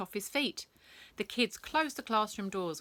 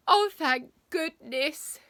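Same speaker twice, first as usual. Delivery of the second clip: higher, louder and slow with relief; relieved exclamation; 8 semitones higher, 9 dB louder, slower than usual. Acted. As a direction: gush it out in relief. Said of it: "exclaimed Roland".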